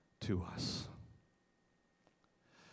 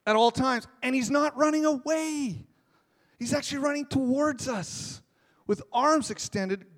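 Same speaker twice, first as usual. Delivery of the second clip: higher, louder preaching with rising energy; full-force preaching; 12 semitones higher, 8 dB louder than usual. Acted. Pastor preaching hard to the congregation, raised and loud.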